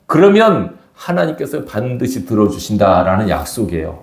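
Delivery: ordinary talk, nothing marked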